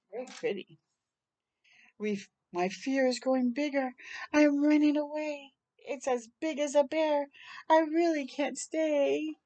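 expressive storybook reading; reading to children. Acted. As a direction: read it like a bedtime book. Reads picture book aloud.

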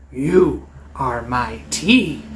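low, slow menacing dragon. Acted. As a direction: savour the words like a hungry beast. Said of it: "the dragon says".

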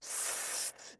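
a long unvoiced hissing স; hissing s sound. Shown as a demonstration. Teacher showing how সিন should sound, a soft hiss. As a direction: let the স hiss out with no voice. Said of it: "এরকম শব্দ যেন অনুভব হয়".

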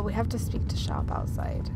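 usual speaking voice

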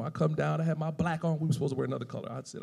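ordinary delivery

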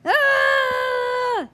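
long high loud cry; frustrated scream. Real, not acted. Sudden loss in game.

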